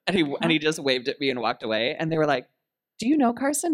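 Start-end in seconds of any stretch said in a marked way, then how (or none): 3.0-3.7 amazed question. Retold or imitated. Fans asking amazed.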